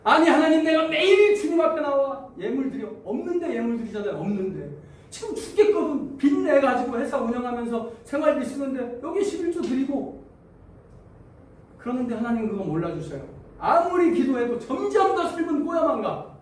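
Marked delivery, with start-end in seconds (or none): 0.0-10.2 high, loud protest pouring out grievances; indignant complaint to God. Acted. Believer listing offerings and debts to God, indignant complaint. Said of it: "막 속에서 욕 나와". 13.6-16.3 high-pitched exasperated grievance; aggrieved protest. Acted. Believer arguing with God over unanswered prayer, aggrieved protest. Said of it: "이렇게 따지는 거예요".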